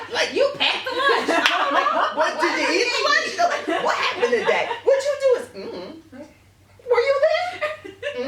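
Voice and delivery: very high, loud, laughing through the quotes; cracking up acting out the lunch talk. Real, not acted.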